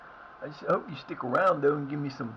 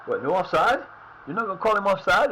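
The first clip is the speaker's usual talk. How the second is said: angry protest; 5 semitones higher, 6 dB louder than usual. Real, not acted. Protesting the referee's call.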